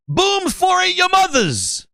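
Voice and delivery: high, drawn-out mock announcement; boastful gamertag fan. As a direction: proclaim the silly gamertag high and slow. Fan announces his gamertag to host.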